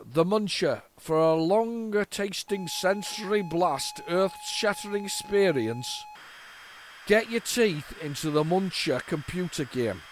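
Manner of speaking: slow, hammed-up Yorkshire ad read; slow mock Yorkshire commercial voiceover; Yorkshire advert voice. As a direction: sell it like a Yorkshire telly advert. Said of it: "I told you it was from Yorkshire".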